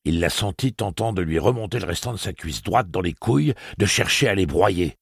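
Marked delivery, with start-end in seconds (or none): none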